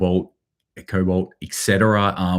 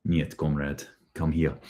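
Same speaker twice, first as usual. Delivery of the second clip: lower, softer mock Russian accent; a menacing comrade in a cheesy Russian accent; bad Russian accent; 3 semitones lower, 7 dB quieter than usual. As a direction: Russia threatening Ukraine, in a low hammed-up Russian accent. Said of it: "I apologize about my shitty accents".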